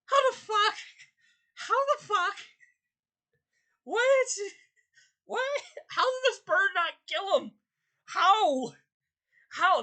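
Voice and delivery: high, loud, cracking cries of disbelief; outraged high wailing how; exasperated disbelief. Retold or imitated. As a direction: shriek it like you can't believe it. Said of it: "and I'm like".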